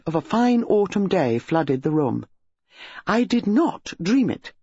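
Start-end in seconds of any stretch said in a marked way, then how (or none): 3.1-4.5 slow, firm insistence; firmly. A narrator's reading. Wife firmly insisting to her husband she did not dream it. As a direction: plant each word, not budging. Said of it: "said Missus Bantry firmly".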